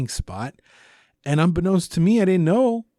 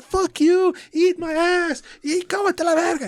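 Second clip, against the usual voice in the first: high mock yell, much higher; yelling insults. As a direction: hurl insults at the neighbor, pitched way up. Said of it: "we started yelling at her".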